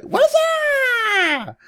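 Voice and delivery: very high, long drawn-out screech; piercingly high mock call, held long; imitated wife's call. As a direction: mimic a wife hollering for her husband. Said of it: "every time his wife would call, she'd be like".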